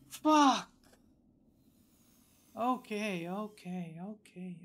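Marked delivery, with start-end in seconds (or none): 0.1-0.7 loud, high burst of a swear; real high-pitched exclamation; exclaimed curse. Real, not acted. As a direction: let the curse burst out after the ordeal. Relief after hard boss fight.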